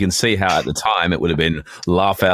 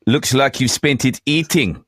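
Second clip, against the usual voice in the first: slow, higher-pitched comeback; acted Cullinan; 5 semitones higher, slower than usual. Co-host as Cullinan answering Warnie, slow drawn-out retort. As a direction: draw the retort out slowly, pitched up. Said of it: "I'll be Warren, you be Cullinan".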